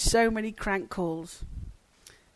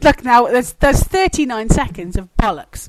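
loud exasperated complaint; fed up; 11 dB louder than usual. Real, not acted. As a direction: declare the whole attempt useless, loudly. Fed up with botched start.

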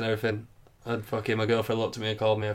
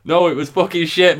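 louder, higher, punchline blurted out; loud blunt reversal; 6 semitones higher, 7 dB louder than usual. Acted. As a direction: drop the act and blurt it loudly. Loud reversal of fake praise.

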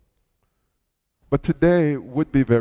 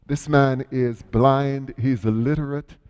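ordinary delivery

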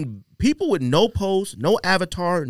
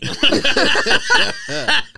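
high laugh; 10 semitones higher, 4 dB louder than usual. Real, not acted. Laughing at silly commenter.

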